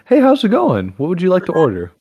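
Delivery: fake cheerful. Shown as a demonstration. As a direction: flip into customer-service cheer. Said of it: "I could easily go from".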